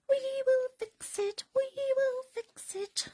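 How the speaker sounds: very high, tiny cartoon mouse voice; squeaky little mice. Remembering the mice fixing things, in a tiny squeaky voice. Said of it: "That's what the little mices used to do".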